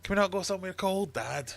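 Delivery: put-on high complaining kid voice; whiny tern child.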